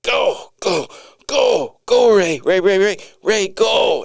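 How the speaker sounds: high, loud frantic yell. Retold or imitated. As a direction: scream it at a running friend. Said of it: "Derek yells".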